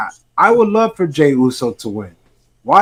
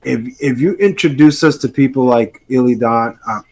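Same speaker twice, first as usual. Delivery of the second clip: ordinary talk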